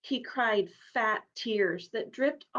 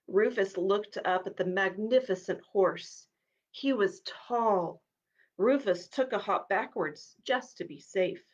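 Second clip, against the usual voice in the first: usual speaking voice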